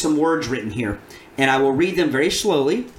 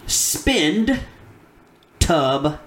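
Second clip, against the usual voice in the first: sounding out words; 3 semitones higher, much slower than usual. Shown as a demonstration. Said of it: "reading them slowly".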